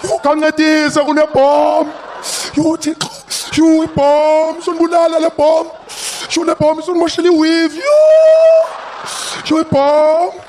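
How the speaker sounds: put-on high, loud, panicked South African accent; frantic, shouting in a South African accent. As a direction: yell about the bomb in a panicked township accent. Panicking bomb helper, shouting in accent.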